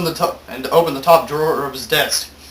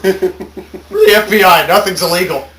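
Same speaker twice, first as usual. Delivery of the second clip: laughing; 4 semitones higher, 6 dB louder, slower than usual. Real, not acted. Mock tough reply, laughing.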